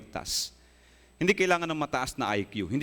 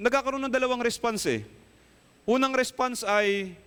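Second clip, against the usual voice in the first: full-force preaching; 9 semitones higher than usual. Acted. Pastor warning the congregation, raised passionate preaching. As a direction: drive the point home to the congregation.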